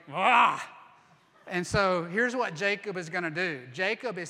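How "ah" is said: sudden loud heave of a cry; effort shout. Retold or imitated. Jacob heaving the stone, loud effort shout. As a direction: roar as you lift. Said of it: "he's like".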